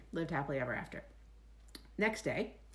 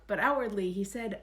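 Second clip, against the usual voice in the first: ordinary delivery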